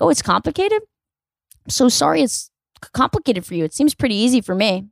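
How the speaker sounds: high, mock-sweet questioning opening; sugary snark, easing down from a high start; snarky but nice. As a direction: feign a sympathetic apology with a snarky edge. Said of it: "ride the line of snarky and nice".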